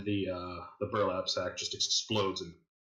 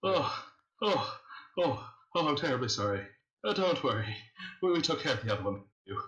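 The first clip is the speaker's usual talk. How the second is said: higher, mock apologetic; amused villain host; 6 semitones higher than usual. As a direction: feign sorry while still amused. Host addresses party, amused.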